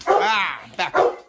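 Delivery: loud, high yelp then a sharp order; the dog shouting to keep the spray away; loud dog protest. Pretend. Cologne spray threat.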